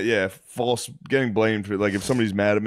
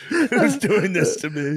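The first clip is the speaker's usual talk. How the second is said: mock panic; 9 semitones higher, slower than usual. Friend acting the dream.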